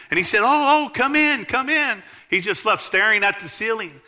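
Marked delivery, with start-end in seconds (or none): 0.4-2.0 excited welcome. Retold or imitated. Pastor welcomes Tiffany in.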